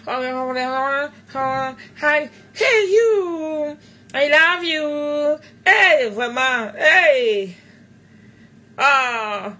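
a hammed-up slow swoon in French-accented English; mock swooning. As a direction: melt over the imagined American. Swooning at imagined American.

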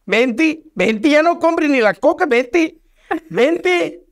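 high-pitched acted call out the window; husband hollering down, without real volume. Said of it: "se asoma por la ventana y le dice".

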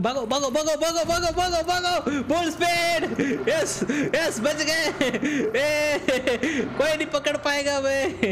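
high-pitched, urgent run-away chant rising into triumph; excited escape cheering. Real, not acted. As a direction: urge everyone to flee, then crow in a high voice. Fleeing pursuers in game.

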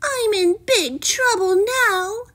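high, dismayed princess voice; high-pitched oh-dear tone; worried princess. A narrator's reading. Princess dreads trouble.

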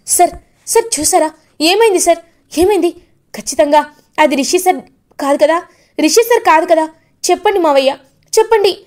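high, pleading, rising questions; anxious begging. Acted. Vasudhara begging her father-in-law for news, high anxious pleading. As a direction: beg him to say it isn't true.

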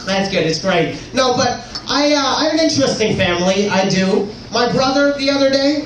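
ordinary delivery

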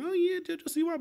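high, soft kiddy squeal of delight; a child gushing over their idol; little kid fan. Pretend. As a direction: play an excited little kid. Little kids excited about JoJo.

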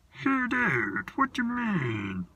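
high, slow creature voice; high and drawn out like a tiny gold creature; Snoswatch creature voice. Acted. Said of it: "And he talked like this".

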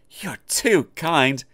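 high, slow, coy giggle; a shy little giggle through the words; giggling modestly. A narrator's reading. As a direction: modest tank engine tittering at praise. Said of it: "giggled Oliver modestly".

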